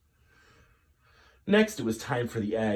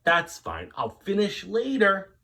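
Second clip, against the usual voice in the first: forced cheer; 10 semitones higher, 6 dB louder than usual. Acted. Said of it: "Missus Greenberg said".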